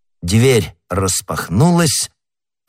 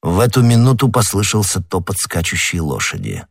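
ordinary delivery, nothing marked

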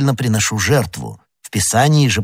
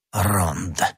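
dismissive Jesuit sneer, understated; contemptuous; 6 dB quieter than usual. A narrator's reading. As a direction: say it with quiet disdain. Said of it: "презрительно произнёс иезуит".

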